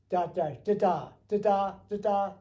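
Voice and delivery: rhythmic da da beeps imitating a Morse A tone; radio A signal. Shown as a demonstration. Explaining the flight radio range, mimicking the A signal beeps. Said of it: "Now there was an A, which was".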